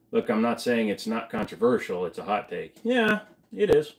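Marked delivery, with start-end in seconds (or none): 2.8-4.0 mock voice. Mock agreeing with hot take.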